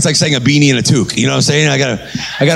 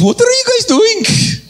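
much higher, slow squeaky Indian guy; slower than usual. Retold or imitated. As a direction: pitch way up, puzzled. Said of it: "He goes".

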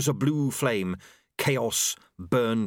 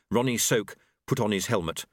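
usual speaking voice

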